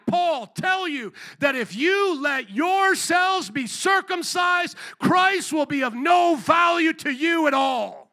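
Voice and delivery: slow it down and boom it out to the congregation; preached shout. Retold or imitated. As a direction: pastor shouting Paul's warning to the church, slow and loud. Quoting Paul, now at full force.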